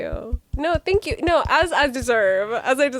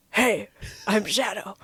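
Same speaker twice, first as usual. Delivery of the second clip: Shadow the Hedgehog; 3 semitones lower, slower than usual. Pretend. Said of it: "give me Shadow the Hedgehog".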